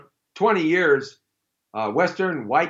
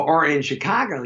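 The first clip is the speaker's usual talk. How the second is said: usual speaking voice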